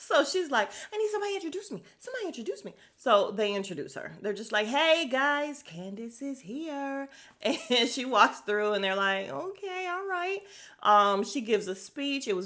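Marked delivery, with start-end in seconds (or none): 0.9-2.7 high-pitched imitation, getting softer; high, hammed-up little demand; mock diva plea. Candace demanding introduction, retold. 4.7-7.1 high, drawn-out mock introduction; singsong imitation of an obliging introduction; half-hearted announcement. Guests announce her, retold. 9.3-10.4 high, softened polite response; thin show of going along; lukewarm mock approval. Guests' lukewarm response, retold.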